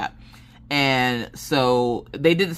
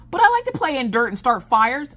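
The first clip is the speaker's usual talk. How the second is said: put-on much higher, pleading kid voice; little girl's voice; 12 semitones higher, 4 dB louder than usual. Mother imitating her daughter begging the scout leader, high pleading voice. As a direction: whine it like a small girl who won't give up.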